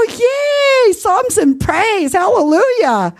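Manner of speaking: high, gushing hallelujah cheer; joyful cheer. Retold or imitated. Cheering the caller who sings in the choir, gushing. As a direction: squeal it out with delight, slowing on psalms.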